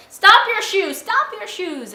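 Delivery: high, loud obnoxious yell; rowdy shouting. Retold or imitated. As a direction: holler it like a noisy drunk tourist. Rowdy teasing shouted in subway.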